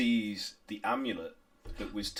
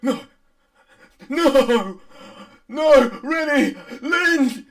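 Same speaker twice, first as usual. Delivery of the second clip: high, very loud cries of horror; father's horrified wail; anguished screaming; 15 dB louder, much slower than usual. Acted. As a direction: scream for your children in desperate grief. Father finds his children's severed heads.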